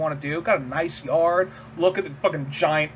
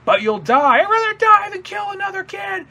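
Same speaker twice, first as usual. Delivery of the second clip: put-on much higher, desperate boy's voice; pleading kid; 4 dB louder than usual. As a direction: refuse like a frightened kid. Shinji refusing to fight.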